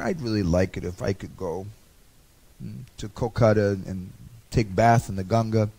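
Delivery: soft, low longing murmur; softly pleading to be allowed to go; wistful wish. Retold or imitated. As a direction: sigh it out as a quiet wish. Sachi Devi voicing her wish.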